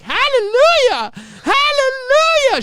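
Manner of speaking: high, loud, drawn-out joyous cry; ecstatic hallelujah. Retold or imitated. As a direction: belt it out like a gospel praise. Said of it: "She literally said that".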